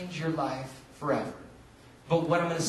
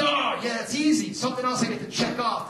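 eager self-quote; 5 semitones higher than usual. Retold or imitated. Retelling his eager reply to God.

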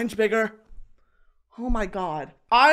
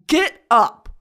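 self-command; 4 semitones higher, 7 dB louder than usual. Retold or imitated. Ordering herself up in retold self-talk.

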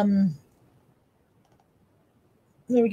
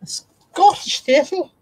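put-on slow, high, botched Scottish accent; drawn-out fake Scottish accent; terrible Scottish accent; 6 semitones higher, 3 dB louder, much slower than usual. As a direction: attempt Scottish and miss badly. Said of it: "that was a terrible Scottish accent".

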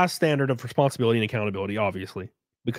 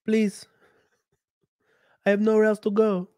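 put-on lightly accented maid, begging, higher-pitched; pleading Consuela; 5 semitones higher than usual. Maid begs her boss not to throw her out, accented plea. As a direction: beg in the maid's Spanish accent.

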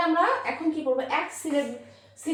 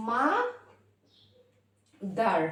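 মা, long pause, দার; split in two syllables; slower than usual. Shown as a demonstration. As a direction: break the word into মা and দার. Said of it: "mother হয়েছে দুইবার". (মা দার, দুই ভাগে থেমে)

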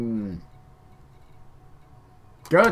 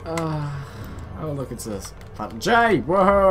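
usual speaking voice

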